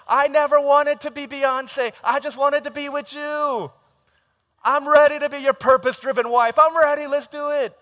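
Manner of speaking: high, loud gushing acceptance; gushing yes. Retold or imitated. Bride accepting the proposal, gushing high and loud. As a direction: swoon out yes yes yes.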